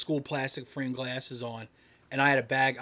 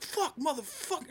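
much higher but softer mock fury; getting mad; 12 semitones higher, 6 dB quieter than usual. Retelling his anger at the insult, high muttered fury. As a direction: fume under the breath in a high pitch. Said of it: "I'm getting all mad".

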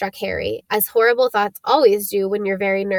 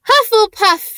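high, loud announcement of the house; shouting hat; 11 semitones higher, 6 dB louder than usual. Acted. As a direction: call out the house name proudly for the whole hall. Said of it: "shouted the hat".

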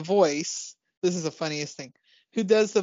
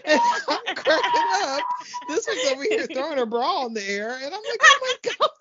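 high, helpless laughter through the words; barely getting the story out for laughing; cracking up; 9 semitones higher than usual. Real, not acted. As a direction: tell it while cracking up laughing.

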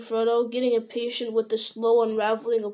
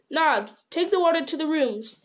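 lightly voiced innkeeper calling to a servant, a touch higher; landlord calling out; 6 semitones higher than usual. Innkeeper calls to Nob to bring water, a touch higher. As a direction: call the order across the room.

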